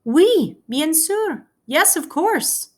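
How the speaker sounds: put-on high, slow, delighted French accent; eager French reply. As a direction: answer like a young man thrilled to say yes, in a French accent. Peter answers, French accent voiced.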